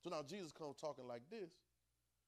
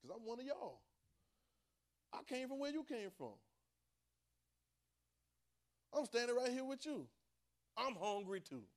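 high, ringing preacher's cry; preaching; 8 semitones higher, 5 dB louder than usual. Real, not acted. Preacher telling the congregation Jesus is one of them, fervent preaching. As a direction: lift it up as a fired-up sermon.

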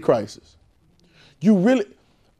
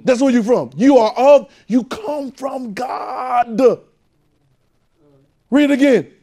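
high, loud, rising preacher's call; full-force preaching; 7 semitones higher, 4 dB louder, slower than usual. Real, not acted. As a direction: preach it hard to the room.

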